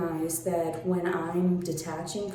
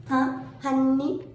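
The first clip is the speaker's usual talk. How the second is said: Huh, honey? pitched high and drawn out slowly; mocking honey; 6 semitones higher, slower than usual. Taunting the critic, a high, stretched honey?. A sarcastic tag aimed at the critic.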